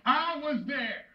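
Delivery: accusing. Acted. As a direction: hurl it at your son as a grieving father. Acted father confronting condemned son.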